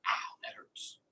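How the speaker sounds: unvoiced pained whisper; whispered ouch. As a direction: whisper a small cry of pain. Hit by a pitch.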